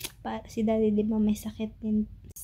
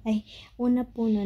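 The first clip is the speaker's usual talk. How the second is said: usual speaking voice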